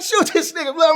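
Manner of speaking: high, loud taunt; high mock yell urging a shot; imitating egging on. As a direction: urge the shooter on. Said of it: "They like".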